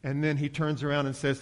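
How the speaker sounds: ordinary delivery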